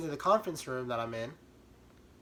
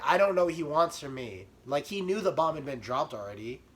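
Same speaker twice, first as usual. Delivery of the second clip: ordinary talk, nothing marked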